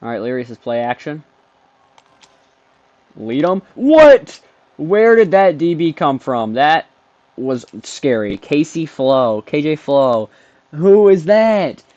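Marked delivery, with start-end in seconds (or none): 3.7-6.5 high, loud burst of disbelief; startled yell. Real, not acted. Startled by a defender. 10.7-11.8 high, loud, drawn-out disbelief; astonished shout. Real, not acted. Astonished at an unknown player.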